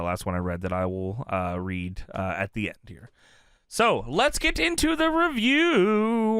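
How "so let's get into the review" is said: high, slow announcer voice; announcer intro. Acted. Host launching the review to his co-host, slow announcer style. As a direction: kick off the show like a host.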